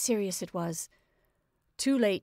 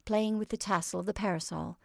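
usual speaking voice

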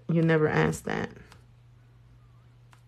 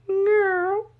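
high squeal. Real, not acted. Squealed address.

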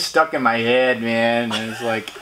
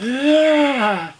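mock shocked yeah; 5 dB louder than usual. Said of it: "reacting to catcall".

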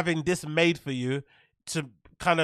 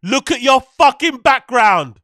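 loud mocking; 6 semitones higher, 9 dB louder, slower than usual. Real, not acted. Mocking rant at clip.

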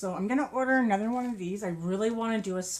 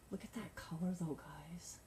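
confiding whisper; 2 semitones lower, 11 dB quieter than usual. Real, not acted. Showing yarn color to viewers.

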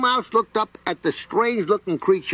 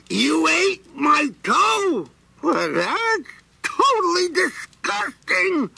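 disgusted creature shout; 10 semitones higher, slower than usual. Acted. Creature shouts at the mouse in slow disgust. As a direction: explode with disgust.

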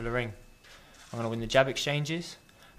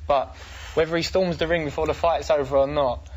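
usual speaking voice

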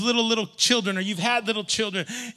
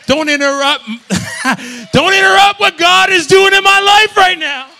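much higher, louder, half laughing; laughing shout; 11 semitones higher, 17 dB louder than usual. Acted. Preacher joking about ignoring texts, laughing shout.

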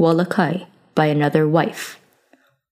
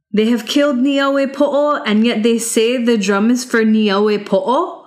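higher, incredulous retort; young hero scoffing; 5 semitones higher than usual. Acted. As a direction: throw their words back at them. Youth scorns the guards' answer.

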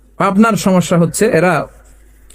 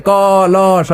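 drawn-out sing-song recitation; pupils repeating; much slower than usual. Retold or imitated. Preacher plays the pupils answering their teacher, slow mock recitation. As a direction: imitate a class chanting letters slowly. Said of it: "সবাই কয়".

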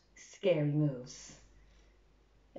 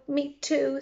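small creature voice; 7 semitones higher than usual. Acted. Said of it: "says a little ladybird".

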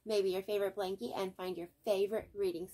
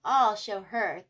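determined; 8 dB louder, slower than usual. A narrator's reading. Arthur resolving after teasing.

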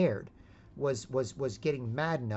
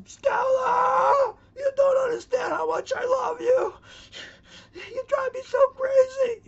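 anguished howl, much higher and louder; Marlon Brando Stella cry; 9 dB louder, slower than usual. Retold or imitated. Stanley begging his woman from the street, howling. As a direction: bellow it like a heartbroken brute in the street. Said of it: "that classic Marlon Brando scene".

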